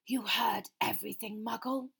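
cold, measured villain. A narrator's reading. Master questioning the intruder Frank, cold voice. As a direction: question him in a cold, controlled voice. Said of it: "said the cold voice".

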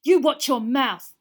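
very loud, slow furious shout. A narrator's reading. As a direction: roar the warning. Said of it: "shouted Ron".

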